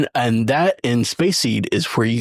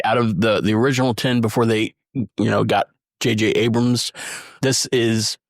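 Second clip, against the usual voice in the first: normal talking voice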